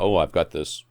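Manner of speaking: usual speaking voice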